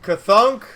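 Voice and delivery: loud high rising kathunk; heavy clunk. Retold or imitated. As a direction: make the shell's impact noise. Imitating artillery shell impact.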